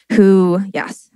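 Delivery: ordinary talk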